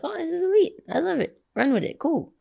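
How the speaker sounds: high, bright delighted gush. Retold or imitated. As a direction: gush over the idea happily. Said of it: "And I was like".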